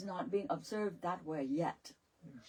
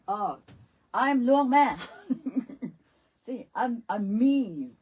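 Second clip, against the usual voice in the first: mock proud boast; 3 semitones higher, 11 dB louder, slower than usual. Acting out the ignorant self.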